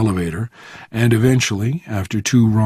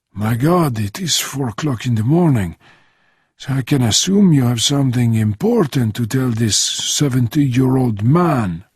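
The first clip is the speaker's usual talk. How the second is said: put-on French-accented old man woken too early; old Frenchman, grumbling; 2 semitones higher than usual. Old Frenchman answers a four o'clock call, grumbling. Voices Bernardin woken at night.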